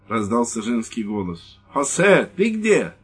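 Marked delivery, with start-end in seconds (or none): 1.7-2.9 high, loud woman's call; calling wife. Acted. Wife calling her husband from inside, high and loud.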